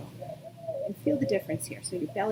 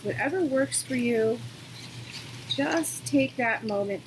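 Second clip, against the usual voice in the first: calm, unhurried yoga-teacher voice with long pauses; guided meditation. Real, not acted. Yoga teacher settling students into breathing, calm guidance.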